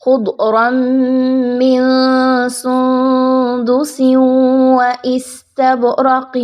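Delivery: very slow, hushed melodic chant; Quranic recitation. Shown as a demonstration. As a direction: breathe the verse out slowly, almost whispered. Recites verse.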